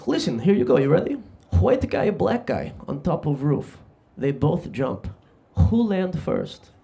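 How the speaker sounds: Serbian cabbie telling a joke; Serbian accent. Retold or imitated. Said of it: "that's a Serbian accent".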